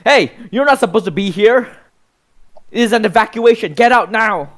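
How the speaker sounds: high, loud evacuation order; shouting official. Acted. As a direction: bark an urgent evacuation order at intruders. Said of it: "you hear a voice go".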